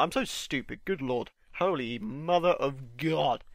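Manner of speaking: ordinary talk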